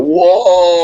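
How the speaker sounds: high, loud drawn-out name call; imitated shout across a room; mock yell. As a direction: yell the name long and high, like a heckling fan. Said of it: "walk up to him and yell".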